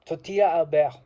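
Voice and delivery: name said the French way, tortilla as tor-tee-ya, Albert as al-BEHR; French accent. Shown as a demonstration. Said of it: "he, uh, said in a French accent".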